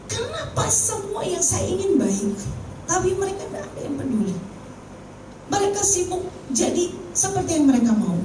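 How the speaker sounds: tearful plea. Retold or imitated. Teacher weeping to the speaker after the seminar, high and soft. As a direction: voice her hurt like she's close to tears. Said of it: "Dia nangis dan dia bilang".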